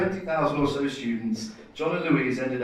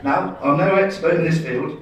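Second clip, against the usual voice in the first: normal talking voice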